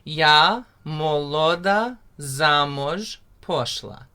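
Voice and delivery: slow, carefully spoken "Yahodka u boru rosla" with rolled r; teacher modelling a Ukrainian line; slow Ukrainian demo. Shown as a demonstration. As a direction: spell out "Yahodka u boru rosla" slowly for learners. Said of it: "say the second line with me again".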